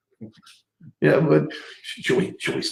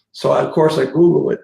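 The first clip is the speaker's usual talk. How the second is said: normal talking voice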